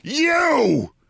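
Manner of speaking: sudden high accusing shout; explosive "You!" at Bill Maher. Retold or imitated. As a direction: jab it out in recognition. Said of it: "I shout at Bill Maher".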